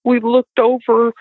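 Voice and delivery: high, imitating a scared boy; frightened son. Son telling mom what they saw, high and scared. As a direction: tell it like a shaken child. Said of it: "and he said".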